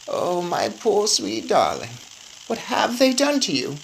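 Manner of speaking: slow, grieving old voice, lightly voiced; tearful grandmother. A narrator's reading. Grandmother crying over her grandson, slow grieving voice.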